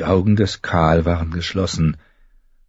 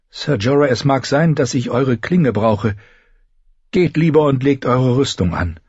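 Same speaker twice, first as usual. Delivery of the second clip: higher-pitched voice of a queen giving orders; raised-pitch character voice, composed command; young queen Dani; 8 semitones higher than usual. A narrator's reading. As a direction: voice Dani addressing her knight.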